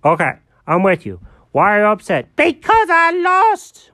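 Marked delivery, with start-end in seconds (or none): 2.3-3.9 whiny loss. Acted. Jenkins whines about losing.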